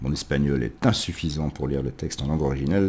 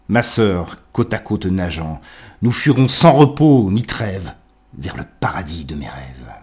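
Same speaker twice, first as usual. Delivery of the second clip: poetry recitation; 2 semitones higher, 6 dB louder than usual. Acted. Lit Le vin des amants. (récitation lyrique, avec des élans puis une retombée)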